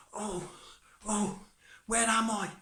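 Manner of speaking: high, startled little voice; alarmed waking. Acted. Tom wakes up among big teeth, high and alarmed. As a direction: wake up bewildered, pitch shooting up.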